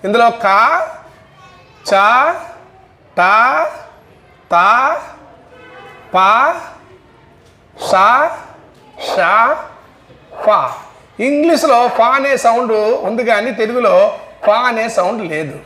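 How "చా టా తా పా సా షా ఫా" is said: each sound said alone and crisp, చా, టా, తా, పా, సా, షా, ఫా with a pause after each; voiceless sounds drill. Shown as a demonstration. Teacher drilling students on voiceless sounds, slow and distinct. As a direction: sound out చా టా తా పా సా షా ఫా one at a time, clearly.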